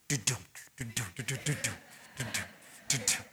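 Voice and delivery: low, soft du-dum beat mouthed like a bass line; imitated reggae beat. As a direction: hum the club's reggae rhythm under your breath. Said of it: "Reggae vibe".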